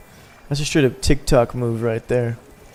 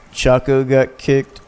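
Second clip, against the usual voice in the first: ordinary talk, nothing marked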